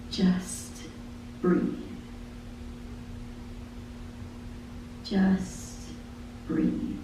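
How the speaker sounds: breathy whispered refrain. Acted. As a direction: whisper it like a gentle calling. Soft refrain in lyric.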